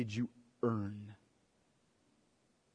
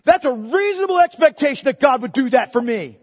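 mocking entitled voice, higher and louder; mock entitlement; 12 semitones higher, 17 dB louder than usual. Preacher mocking an entitled believer, loud. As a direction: lampoon the smug demand. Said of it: "and you thought God owed you".

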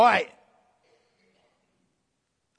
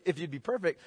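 much softer and lower, a hushed, tender call; gentle invitation; 9 semitones lower, 11 dB quieter, faster than usual. Retold or imitated. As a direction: say it quietly, like an invitation to follow. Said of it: "Jesus says to him".

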